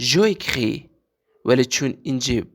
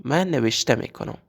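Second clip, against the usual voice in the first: ordinary delivery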